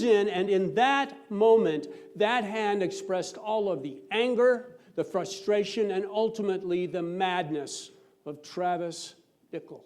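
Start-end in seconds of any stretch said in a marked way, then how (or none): none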